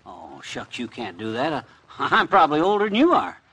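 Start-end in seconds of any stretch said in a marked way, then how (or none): 0.0-1.6 bashful mumble. Acted. Embarrassed protest.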